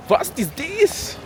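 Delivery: slow, high mock German; hammed-up German accent. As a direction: drag out the German words like a tourist. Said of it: "I think I'm from Germany".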